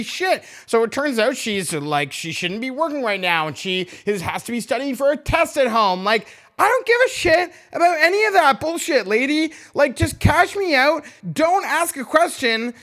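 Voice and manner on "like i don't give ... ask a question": irritated rant. Real, not acted. Ranting at the cashier.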